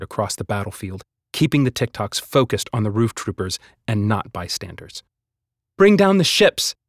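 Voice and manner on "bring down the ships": high, loud battle call; rally the crowd with a ringing cry; ringing command. A narrator's reading. Hazel rallying the fighters, loud ringing shout. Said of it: "Hazel's voice rang across the chaos".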